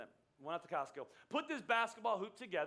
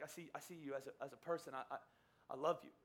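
tender reassurance; 5 semitones lower, 7 dB quieter than usual. Retold or imitated. Tender words while holding eye contact.

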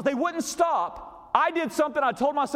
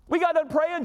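normal talking voice

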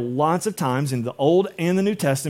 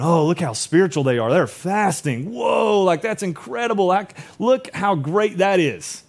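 mock admiration; 4 semitones higher than usual. Preacher voicing people impressed by showy fasters, mock admiration. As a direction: gush like an easily impressed onlooker.

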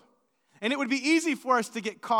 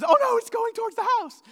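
high, fast panicked cry; acted alarm, pitch shooting up; imitated freak-out; 11 semitones higher, 4 dB louder, faster than usual. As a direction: rush it out high, imitating a frightened girl. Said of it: "Jaden is freaking out".

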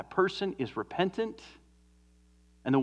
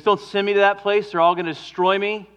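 louder, higher, hammed-up panicky plea; pleading Jonah; 6 semitones higher, 7 dB louder than usual. Preacher voicing a frightened Jonah, louder mock plea. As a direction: beg not to be sent, half-mocking. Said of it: "And he goes".

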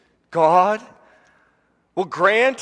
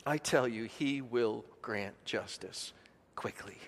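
hushed preaching; 8 semitones lower, 14 dB quieter than usual. Real, not acted. Preacher to congregation on God's faithfulness, hushed. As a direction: drop to a reverent hush. Sermon climax dropping to hush.

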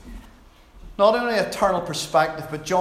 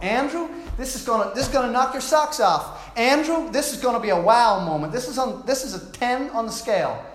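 higher-pitched American tour guide; excited American-accented sales pitch; 4 semitones higher than usual. Acted. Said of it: "that's my American accent".

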